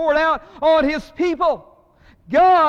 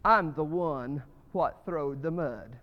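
put-on slow, low, defiant boy's boast; defiant little boy; 8 semitones lower, 8 dB quieter, slower than usual. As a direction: puff up your chest and own it slowly. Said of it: "puffed up his chest and said".

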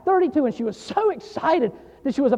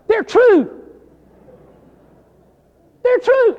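loud and much higher, rising on the repeat; emphatic insistence; 10 semitones higher, 7 dB louder, much slower than usual. Acted. Preacher assuring young pastors that the horror stories are true, loud. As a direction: shout the claim like a preacher.

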